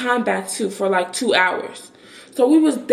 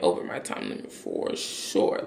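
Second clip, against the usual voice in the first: muttering; 10 dB quieter than usual. Real, not acted. Admitting defiance under her breath.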